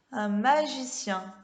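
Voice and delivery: ordinary talk, nothing marked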